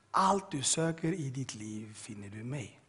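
slow, low, solemn divine voice; voice of God. Retold or imitated. God speaking to the prisoner in his cell, slow and low. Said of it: "Jag hör en röst som säger".

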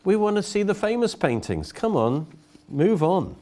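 high, impatient heckle; mock shout from the crowd. Heckler at the auction shouting at the auctioneer, impatient. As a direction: call out like a bored bidder. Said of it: "a voice from the back shouted".

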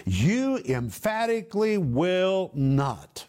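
slow, drawn-out "you emphatically will not"; emphatic. Acted. Preacher explaining the Greek double negative, slow and emphatic. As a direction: stretch "you emphatically will not" out slowly, stressing each word. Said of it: "is emphatic for no".

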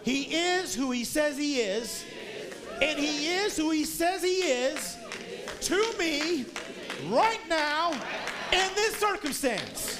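building to a high, loud shout, slow and emphatic; full-force preaching. Real, not acted. Preacher building to a shouted climax for the congregation.

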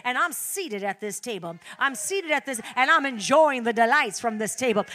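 loud, driving preacher's cadence; ringing pulpit proclamation; preaching at full force. Acted. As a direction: preach it out to the whole congregation.

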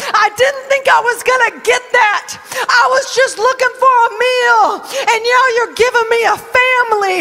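high, loud, near-shouting joy; ecstatic praise. Acted. Ruth praising God for becoming a mother, high and loud. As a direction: cry it out, overwhelmed with gratitude. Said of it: "She's like".

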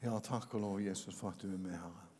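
soft, low, murmured prayer. Acted. Pastor praying over the congregation, soft and devout. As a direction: pray quietly and devoutly.

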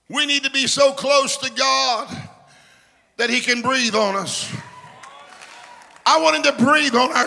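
full-force revival preaching. Acted. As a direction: preach it out to the whole church. Preacher repeating breathe-on litany.

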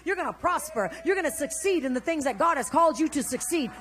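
high, loud pep talk; emphatic self-blessing. Shown as a demonstration. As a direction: cheer yourself on like a fired-up preacher. Models blessing oneself in mirror.

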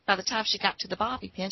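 ordinary talk

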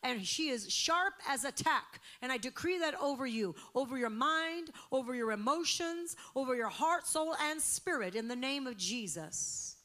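loud, high prayer proclamation; decreeing prayer. Real, not acted. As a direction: declare it over her with full force. Decreeing prayer over someone.

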